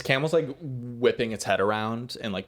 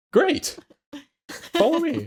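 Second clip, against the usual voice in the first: high NPC voice; 5 dB louder, slower than usual. Acted.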